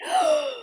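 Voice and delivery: high, sudden gasp; sharp gasp. A narrator's reading. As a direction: gasp in surprise. Dramatic gasp in story.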